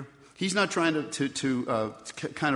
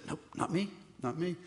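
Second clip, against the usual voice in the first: hushed denial; 2 semitones higher, 10 dB quieter than usual. Acted. Peter denies knowing Jesus.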